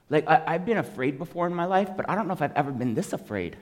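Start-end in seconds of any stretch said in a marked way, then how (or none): none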